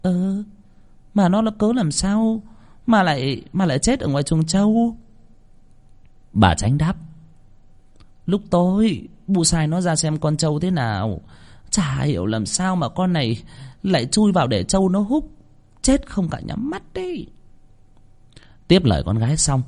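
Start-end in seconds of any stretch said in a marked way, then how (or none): 0.0-5.0 high, hesitant, bewildered voice; stammering. Acted. Daughter asking how the maid died, stammering. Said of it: "Con Miên lắp bắp". 8.3-17.3 high, plaintive village mother; distressed mother. Acted. Mother explaining the death to daughter, distressed.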